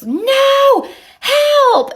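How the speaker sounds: high loud mock scream for help; retold panicked scream. Neighbor lady grabbing her little dog, screaming in mock panic. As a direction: squeal it high like a frightened woman. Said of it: "And she's screaming".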